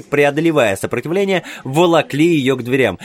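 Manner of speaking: ordinary delivery, nothing marked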